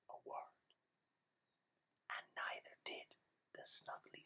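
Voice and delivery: hushed, breathy whisper; whispered ending. A narrator's reading. Storyteller whispering the quiet ending to children. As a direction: whisper the last lines.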